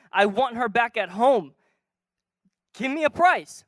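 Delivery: loud, high, pleading declaration; impassioned plea. Acted. Hosea pleading loudly with the slave seller to buy back his wife. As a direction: shout it out like a desperate husband.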